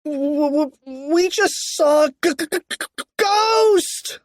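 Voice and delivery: high, stuttering fright; panicked cry. A narrator's reading. George crying out to cheerleaders, high panicked stutter. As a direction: stammer in terror, bursting out on the last word. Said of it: "cried George".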